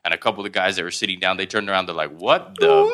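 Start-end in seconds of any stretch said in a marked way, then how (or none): 2.2-2.9 shrieking high and loud in shock; stunned what the. Retold or imitated. Guys on the court seeing a kid dunk, shocked squeal. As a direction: let the disbelief jump way up.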